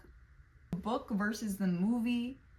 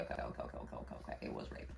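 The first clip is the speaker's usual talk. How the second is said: near-silent, breathy confession; whispered reluctant admission; dropped to a whisper; 12 dB quieter, much faster than usual. Real, not acted. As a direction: let it slip out under the breath. Reluctant to say a dark fact.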